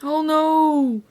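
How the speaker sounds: high, loud panicked cry; shout. A narrator's reading. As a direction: cry out in alarm. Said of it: "shouted Lucy's mother".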